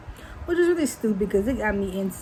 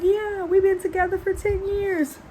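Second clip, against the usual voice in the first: put-on girlfriend voice; 10 semitones higher than usual. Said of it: "She was like".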